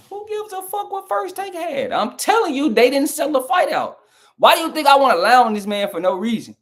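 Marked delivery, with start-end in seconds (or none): 0.1-5.5 high-pitched, exasperated yell at chat; angry outburst. Real, not acted. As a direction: snap back in real frustration. Heated rant at chat commenter.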